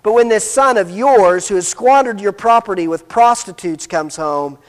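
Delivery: ordinary talk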